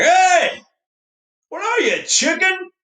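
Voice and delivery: high, loud jeering call; taunting shout. Pretend. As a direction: yell it like teasing a friend. Buddies taunting.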